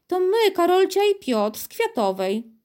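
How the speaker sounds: put-on chirpy child announcing herself; a child's voice. A narrator's reading. Girl introducing herself to the city president, lightly voiced.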